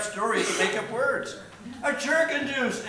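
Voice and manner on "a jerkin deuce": raised, surprised echo; a touch of surprise, pitched up. A narrator's reading. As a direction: echo it in mild astonishment. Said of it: "echoed the others in surprise".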